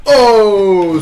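long, loud, falling "oh"; startled exclamation. Real, not acted. Reacts to a hit in game. (« oh » surpris, fort et prolongé)

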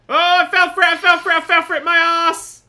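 loud, high repeated wail; exasperated yell. Real, not acted. Player caught by a trap, exasperated yelling. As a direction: holler it over and over in outrage.

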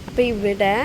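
ordinary delivery

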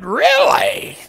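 loud, high incredulous question; hammed-up astonishment; mock disbelief. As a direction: shout it back in shock. Acted shocked reply to guard.

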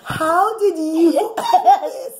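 a high, gasping cry of shock with laughter; girl shocked at the necklace. Acted. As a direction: gasp it out in astonishment. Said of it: "she's shocked".

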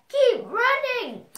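very loud, very high dog's cry; barked shout. A narrator's reading. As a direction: bark it at full volume, pitch leaping up. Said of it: "barked the dog".

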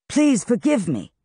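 high-pitched, placating apology; apologetic plea. A narrator's reading. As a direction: plead lightly for forgiveness. Crow appeases glaring Han.

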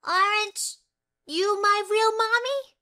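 put-on high, worried child; little girl voice. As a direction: ask like a confused little girl. Child asking foster mother.

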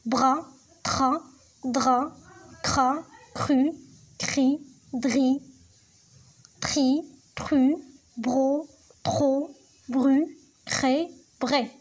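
each syllable said clearly on its own; slow, clear syllable drill. Shown as a demonstration. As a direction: model the sounds one by one for pupils. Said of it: "نعاودوهم".

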